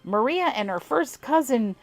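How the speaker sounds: put-on amateur southern accent on an old farmer's tale; southern twang. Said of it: "forgive my god-awful accent".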